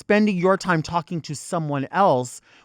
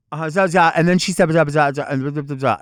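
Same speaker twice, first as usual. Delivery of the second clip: loud garbled clerk gibberish; mock gibberish mumble; 8 dB louder than usual. Clerk on a phone call ignoring the customer, loud gibberish. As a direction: spout loud nonsense like a clerk on a headset. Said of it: "creating gibberish".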